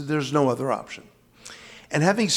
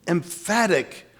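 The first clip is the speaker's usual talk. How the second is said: ordinary delivery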